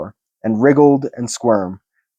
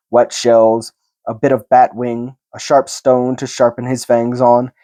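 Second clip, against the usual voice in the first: plain reading voice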